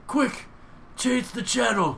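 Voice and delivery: high, slow panicky quote; high panicked movie voice. Retold or imitated. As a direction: squeak it out in a panic. Quoting Zangief movie line.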